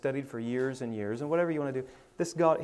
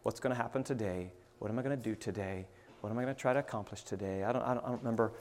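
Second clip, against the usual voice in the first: soft, low thinking aloud; quiet musing to oneself, trailing along; inner thoughts; 4 semitones lower, 9 dB quieter, faster than usual. Retold or imitated. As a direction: murmur it like a private morning prayer. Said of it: "my mind starts going".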